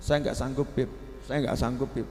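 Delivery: quiet defeated complaint from a tired husband; softly mocking a husband who gives up; weary husband. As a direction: sigh it out softly like a worn-out husband. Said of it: "Para suami juga begitu".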